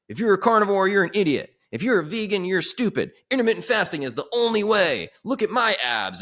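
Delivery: high, loud mocking influencer rant; loud guru rant. Influencer on Instagram lecturing followers, high loud mocking rant. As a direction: ham up a preachy fitness guy.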